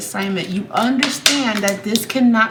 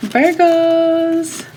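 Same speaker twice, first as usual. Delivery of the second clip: excited call; 8 semitones higher, 5 dB louder than usual. Real, not acted. Excited greeting to her viewers.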